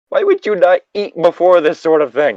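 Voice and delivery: can't believe he didn't eat; exasperated disbelief. Acted. Jamie scolding Sam for skipping dinner, exasperated.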